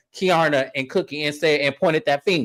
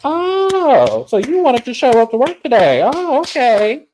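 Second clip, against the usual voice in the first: high, sing-song mock praise, slowing on okay; mocking sarcastic; 8 semitones higher, 4 dB louder, slower than usual. Stacey mocking her coworker with high sarcastic praise. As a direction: sarcastically praise her for finally showing up, much higher. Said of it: "Stacy's like".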